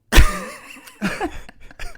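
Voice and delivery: high, loud laugh; real burst of laughter. Real, not acted. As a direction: burst out laughing at your own punchline. Reacts to his own Georgia catch.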